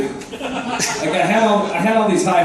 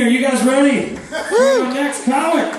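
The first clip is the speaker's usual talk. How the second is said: higher, hyped emcee voice; announcer intro; 9 semitones higher than usual. Acted. Comic hands off to the next act, hyped announcer. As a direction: bring the next comic up with hype.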